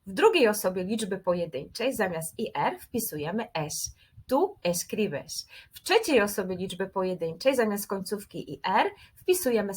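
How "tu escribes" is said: slow, careful model of the Spanish form. Shown as a demonstration. Teacher models the Spanish form for students, slow and careful. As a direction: spell out Tu escribes slowly for the class. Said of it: "teacher models verb form".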